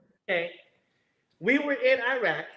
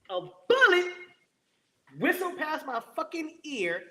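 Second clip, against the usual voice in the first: ordinary talk, nothing marked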